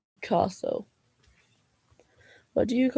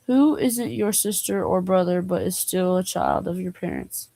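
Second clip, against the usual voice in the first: ordinary talk, nothing marked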